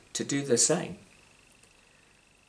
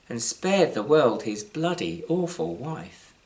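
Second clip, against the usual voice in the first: ordinary delivery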